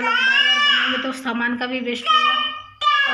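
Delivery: high, loud burst about waste; exasperated shout. Real, not acted. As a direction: raise the voice high and loud in frustration. Shouting over baby noise. (झुंझलाहट में ऊँची, तेज़ आवाज़ में बोलना)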